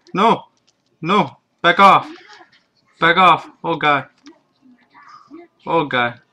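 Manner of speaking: panicked yelling while fleeing; terrified horror victim. Real, not acted. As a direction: shout in terror, running away.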